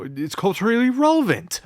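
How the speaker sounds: high, loud justification; defensive protest. Acted. Defensive about his book choice.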